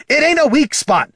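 high, loud angry retort; snapped. A narrator's reading. Dwarf correcting the bard, snapped. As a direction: snap back hotly. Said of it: "snapped Gorm".

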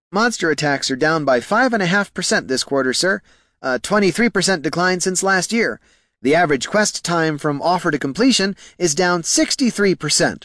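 high-pitched, anxious report to superiors; nervous junior clerk. Acted. Clerk listing worrying figures to his bosses, nervous. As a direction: reel off bad figures in a high, earnest voice.

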